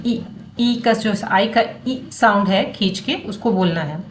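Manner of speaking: normal talking voice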